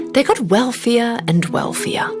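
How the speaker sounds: plain reading voice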